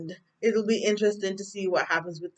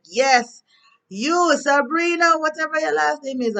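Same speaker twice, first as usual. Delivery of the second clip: loud accusing; 10 semitones higher, 6 dB louder than usual. Retold or imitated. Jacoby accusing Sabrina at the bank, loud and high. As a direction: call her out by name at full volume. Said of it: "being all loud".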